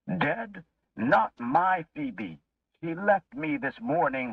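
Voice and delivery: put-on old man clinging to his wife in disbelief; shocked disbelief. A narrator's reading. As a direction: refuse to believe the news. Henry denies wife's death.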